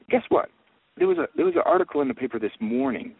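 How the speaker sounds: high, loud excited reveal; spring the surprise on them. Real, not acted.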